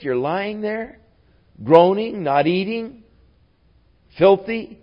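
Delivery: high-pitched, baffled retold questioning; incredulous questioning. Baffled servants to David after the child's death, incredulous.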